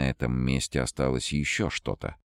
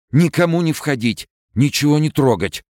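much higher, louder panicked order; frightened shout; 14 semitones higher, 8 dB louder than usual. A narrator's reading. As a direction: bark it out in terror. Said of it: "булькающим голосом испуганного". (испуганный булькающий крик)

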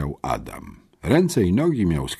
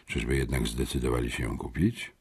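lowered, softened voice; hushed aside; 6 semitones lower, 8 dB quieter than usual. A narrator's reading. Friend quietly speculating about the strangers, hushed. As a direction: wonder aloud under your breath. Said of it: "szepnął".

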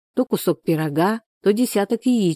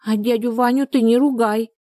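put-on high, childlike pleading voice; higher-pitched earnest little girl; little girl standing up; 5 semitones higher than usual. A narrator's reading. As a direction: defend a friend as a small child. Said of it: "заступилась она за своего странного друга".

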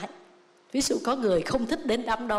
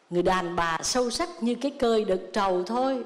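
ordinary delivery

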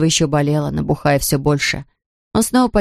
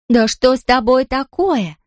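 put-on slow, higher girl's question; lightly voiced puzzled girl; 6 semitones higher, slower than usual. A narrator's reading. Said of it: "услышал голос Марлоты".

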